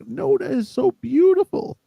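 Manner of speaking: very high, slow mock rapture; gushing praise. Neighbor praising the singer next door, gushing.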